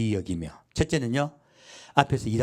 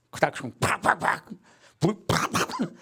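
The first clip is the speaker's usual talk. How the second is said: mock road rage noises; 8 semitones higher than usual.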